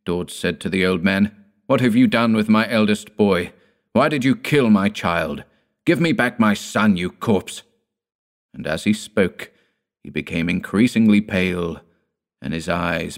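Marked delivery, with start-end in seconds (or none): 1.7-7.6 high, loud anguished accusation; a father's furious grief; grieving fury. Acted. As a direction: hurl it at the old man. Dord accuses his father.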